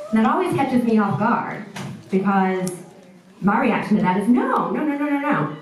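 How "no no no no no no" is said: higher-pitched run of protesting no's; emphatic denial. Retold or imitated. Speaker rejecting people's pity for her job, emphatic denial. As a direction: wave the idea away with quick repeated denials.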